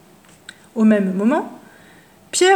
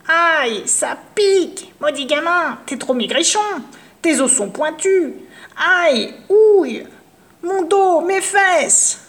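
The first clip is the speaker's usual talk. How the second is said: pained old witch, hammed-up; witch yelping in pain; 5 semitones higher than usual. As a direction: squeal at every thorn. Thorns prick the witch.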